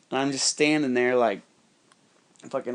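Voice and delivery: ordinary talk, nothing marked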